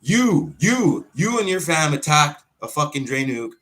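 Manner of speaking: real heated finger-pointing tirade; angry accusation. Real, not acted. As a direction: call them out one by one, loudly. Accusing the bullies directly.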